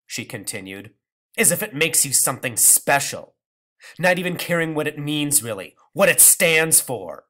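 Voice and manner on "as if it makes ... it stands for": scornful. Acted. Grandmother accusing.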